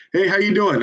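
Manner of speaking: upbeat telemarketer hello; phone greeting. Real, not acted. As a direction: greet the stranger cheerfully. Cold-call greeting on the phone.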